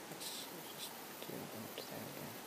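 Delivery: whispered under the breath, to himself; muttered aside. Real, not acted. Tutor fiddling with the software, muttering under his breath. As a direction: mutter it half to yourself while working.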